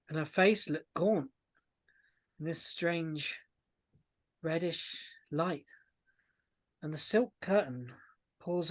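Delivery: slow narration with long suspenseful pauses; ominous scene setting. Acted. Game master revealing blood on the stage, ominous. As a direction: let each horror land slowly, softening on the drips.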